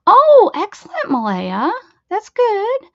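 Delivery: high, loud happy cheer; excited. Real, not acted. Cheering a viewer's fast internet news.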